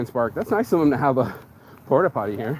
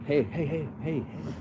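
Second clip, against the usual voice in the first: soft, hushed repeated heys; imitated soothing; 11 dB quieter than usual. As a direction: gently hush someone, acting out the lady. Retold lady calming the man.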